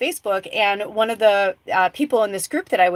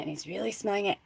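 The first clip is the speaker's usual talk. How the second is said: and he's really smelling it said much softer; hushed repeat; 10 dB quieter, faster than usual. Real, not acted. Telling a friend how he smelled the oil, the repeat hushed and soft.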